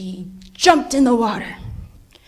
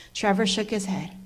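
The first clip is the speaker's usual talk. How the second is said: plain reading voice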